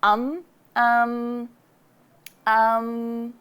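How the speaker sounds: lazy um um of someone thinking; relaxed filler um; schwa thinking sound. Shown as a demonstration. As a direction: hum a lazy um while thinking. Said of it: "We say".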